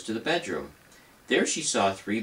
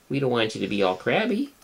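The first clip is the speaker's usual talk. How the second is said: usual speaking voice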